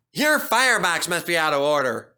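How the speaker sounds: a touch of teasing, not really laughing; mock the big engine lightly; lightly voiced cheeky engine; laughing James. A narrator's reading. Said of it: "laughed James".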